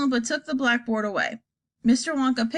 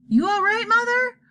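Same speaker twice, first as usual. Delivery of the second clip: put-on high, worried plea to an old mother; anxious; 12 semitones higher, 4 dB louder than usual. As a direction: fret over her mother anxiously. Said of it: "said Missus Bucket anxiously".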